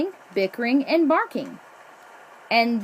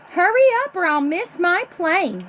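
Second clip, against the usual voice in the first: urgent; 7 semitones higher, 4 dB louder than usual. A narrator's reading. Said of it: "she told the taxi driver".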